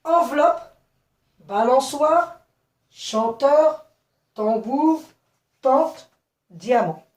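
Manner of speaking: clear, loud list with a pause after each word; word list repeated. Shown as a demonstration. Teacher repeating the word list to pupils, list repeated. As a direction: call out each word separately, lifted until the last. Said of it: "je répète les mots".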